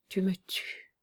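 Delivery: faint whispered last breath; a dying man's breathless whisper. A narrator's reading. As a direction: barely voice the words, dying. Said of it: "d'une voix mourante".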